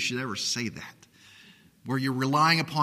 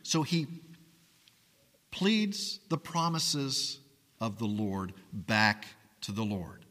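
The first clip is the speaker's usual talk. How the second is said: normal talking voice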